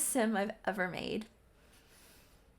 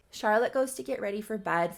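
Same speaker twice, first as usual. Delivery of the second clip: ordinary delivery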